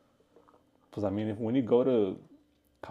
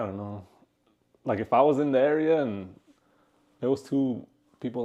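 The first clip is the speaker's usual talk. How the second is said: ordinary talk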